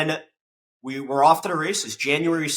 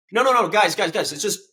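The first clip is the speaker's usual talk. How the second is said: protesting; 7 semitones higher, 4 dB louder than usual. Retold or imitated. Correcting council, waving off firm name.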